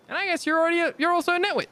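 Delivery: high, teasing character voice; high mocking voice.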